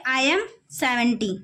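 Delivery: normal talking voice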